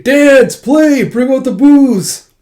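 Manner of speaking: high, loud, drawn-out revel cry; festive shouting. Retold or imitated. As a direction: call out to the whole party, loud and high. Revelers calling out at funeral.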